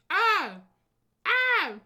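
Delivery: loud, high delighted scream; real thrilled shriek, very loud; excited scream. Real, not acted. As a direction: scream with pure joy. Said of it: "I'm freaking out".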